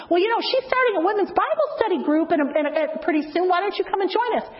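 high, quick, sweet inviting voice; bright invitation. Retold or imitated. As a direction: lift it high and chirpy to win her over. Inviting the waitress to study.

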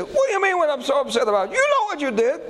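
high, indignant retort; mock-furious wife, voiced high; livid woman. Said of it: "That lady was livid".

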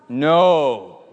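loud, final no; mock-stern, booming refusal; firm refusal. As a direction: shut the child down firmly. Driver refuses the whining child.

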